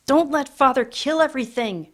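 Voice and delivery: high pleading cry; desperate child. Acted. Peter begs the house to save the nursery, wailing. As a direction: beg the house to stop him.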